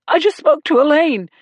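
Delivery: high, loud, sobbing plea. A narrator's reading. Grieving husband telling the sergeant, sobbing. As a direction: speak through sobs. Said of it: "he sobbed".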